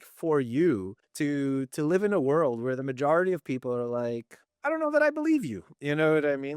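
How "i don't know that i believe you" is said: high, sing-song doubting voice; mock skeptic. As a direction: imitate a skeptical stranger, pitched way up. Mocking the world's doubting reply.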